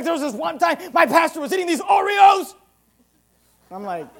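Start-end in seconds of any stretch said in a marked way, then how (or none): 0.0-2.5 high, shouted imitation of an angry man; mock outrage. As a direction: exaggerate the outrage of a scandalised churchgoer. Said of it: "he started getting really mad".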